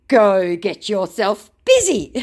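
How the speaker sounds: loud, high, slow dismissal; mock scammer comeback. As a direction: imitate a huffy scammer.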